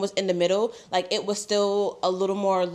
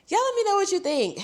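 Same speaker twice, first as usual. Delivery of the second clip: pitched way up, sing-song; high sing-song; 12 semitones higher, faster than usual. Pretend. Repeats sign-off in two voices.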